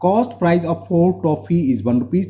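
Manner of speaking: slow dictation. Real, not acted. Teacher dictates working slowly.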